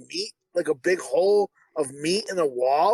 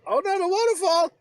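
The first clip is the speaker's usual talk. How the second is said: mock panic cry; 10 semitones higher than usual. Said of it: "then it goes".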